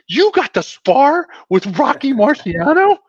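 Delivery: high, drawn-out incredulous question; Joe Montana. Retold or imitated. Star quarterback learning dad sparred with a champion, astonished.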